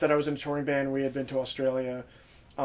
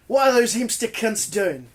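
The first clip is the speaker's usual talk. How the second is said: higher-pitched put-on Australian accent; mock Australian accent; 6 semitones higher, 4 dB louder than usual. Bandmate mimics an Australian heckling dice players, mock Australian accent. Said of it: "insert an Australian accent over that comment".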